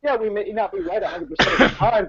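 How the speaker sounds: high, indignant self-defence; exasperated rant. Real, not acted. Exasperated rant at offended viewers.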